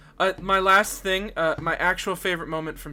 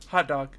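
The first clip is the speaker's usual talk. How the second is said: "Hot dog." falling, stress on HOT; closing inflection; 3 semitones lower than usual. Retold or imitated. Clark replying to Lois in turn, falling inflection. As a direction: finish HOT DOG as a settled statement. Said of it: "with different inflections".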